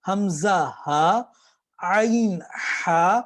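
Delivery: حمزہ ہا then عین ہا drawn out slowly; slow makharij drill; slow letter drill. Shown as a demonstration. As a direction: drill the throat letters one by one. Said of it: "اوپر سے آ جائے گا".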